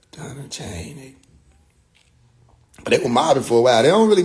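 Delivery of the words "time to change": soft unvoiced slow whisper. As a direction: breathe it out slowly. Whispered repeat.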